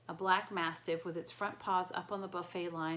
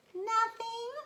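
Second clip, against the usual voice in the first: much higher, louder falsetto squeak; 7 dB louder than usual. A narrator's reading. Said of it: "Grover said in his falsetto voice".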